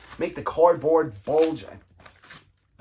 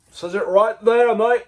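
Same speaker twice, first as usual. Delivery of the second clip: put-on high, loud, slow Australian accent; mock Australian accent; 7 semitones higher, 6 dB louder, slower than usual. Collector showing the record label, in a hammed-up Australian accent. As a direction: play a cheeky Aussie pointing at the label. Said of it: "Well, that's Australian".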